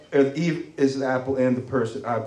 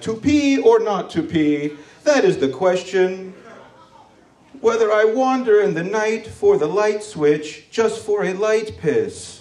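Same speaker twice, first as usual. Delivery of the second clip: slow, higher mock-theatrical Shakespeare recital; Shakespearean recital; 6 semitones higher, slower than usual. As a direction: declaim it like Hamlet on the toilet question. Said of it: "I turn into Shakespeare".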